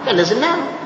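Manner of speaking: normal talking voice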